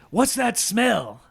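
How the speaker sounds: light Pacino impression, slowed down. Shown as a demonstration. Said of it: "how does he sound again".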